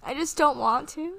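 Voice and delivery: high, tearful protest; fighting back tears. Real, not acted. Answering friend who says crying is okay, fighting back tears. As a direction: resist crying with the voice pushed up high. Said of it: "I don't wanna cry".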